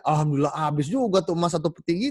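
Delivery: the generous man rejoicing after giving everything; happy to be rid of it. Acted. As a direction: glad the gold is all gone. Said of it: "Happy malah kehabisan emas".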